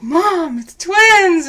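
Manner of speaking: proud to break the news; excited announcement. Retold or imitated. Couple telling her mom about twins, excitedly. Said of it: "so then we're like".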